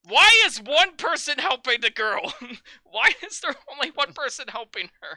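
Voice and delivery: loud, high, incredulous outburst; exasperated yelling. Real, not acted. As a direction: yell the question in disbelief. Mock outrage over the film scene.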